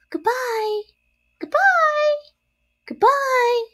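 very high, little spider cries; faint tiny farewells. A narrator's reading. Departing spiderlings call to Wilbur, tiny farewell cries. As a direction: call goodbye in a tiny squeaky voice. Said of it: "came weakly to Wilbur's ears".

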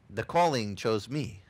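ordinary talk, nothing marked

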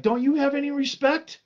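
high-pitched retold scolding; indignant rebuke. Other thief rebukes the mocker.

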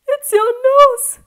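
very high, loud amused shout; gleeful cry. A narrator's reading. Boy mocks the king's nose, gleeful cry. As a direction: cry it out in mock hilarity. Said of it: "cried Paul with laughter".

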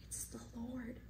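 soft, awed near-whisper; hushed realization. Acted. Disciple tells Peter who is on the shore, hushed. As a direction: breathe it out quietly in recognition.

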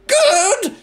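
very high, loud scream. A narrator's reading. Grandma screaming back at George. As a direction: scream the word. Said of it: "she screamed".

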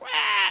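short comic demon yowl; imitated cry of a possessed man; mock wail. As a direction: let out a quick mock "wah".

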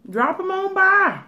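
put-on very high, loud sing-song accent; high sing-song mock Southern invite. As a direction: chirp it like a too-eager friend. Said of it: "I just love to do accents".

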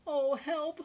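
loud, high cry of alarm; mock whimper. A narrator's reading. Scared lion hearing beasts like eating lions, mock whimper. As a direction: wail it like a frightened storybook lion. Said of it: "whimpered the lion".

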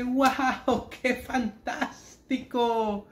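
guau más fuerte, fantástico muy lento; asombro fingido. Acted. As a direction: estira el fantástico, maravillado. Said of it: "Y el hombre dice". (entusiasmo exagerado y lento)

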